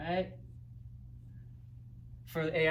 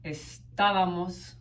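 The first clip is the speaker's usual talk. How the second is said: Estábamos with the TA stretched and punched louder; stress on accented A; 4 dB louder, slower than usual. Shown as a demonstration. Showing students where the accent falls, stressed second syllable in Estábamos. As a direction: say Estábamos slowly, leaning on the á. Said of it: "always gonna have an accented A".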